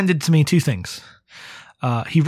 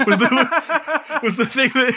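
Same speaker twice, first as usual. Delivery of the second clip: words breaking up in high laughter; laughing; 8 semitones higher, slower than usual. Real, not acted. Recalling the cliffhanger pitch, she laughs while talking. Laughing at her own story.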